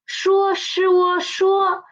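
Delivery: slow 说 split into sh and uo; sounding out. Shown as a demonstration. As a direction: spell the sound of 说 slowly. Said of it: "the initial is 是".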